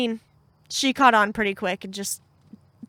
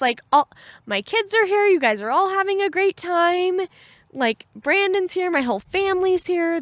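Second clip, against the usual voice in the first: much higher, bubbling over with happiness; gushing joy; 8 semitones higher, 3 dB louder than usual. Retold or imitated. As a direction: gush like it's the best day ever. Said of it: "And I was like".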